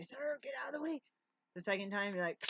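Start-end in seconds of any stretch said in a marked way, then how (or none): 0.0-1.0 higher, put-on voice of a passer-by; lightly voiced stranger shouting a warning; stranger's warning. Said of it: "Someone might say".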